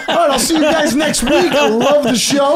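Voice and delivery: laughing mock sign-off, high; giggling through a fake goodbye.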